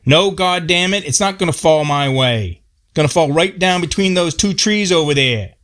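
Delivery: high, heated rant; Paul angrily correcting Robert about the tree; angry. Retold or imitated. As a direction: snap back hotly. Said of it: "Paul got really angry".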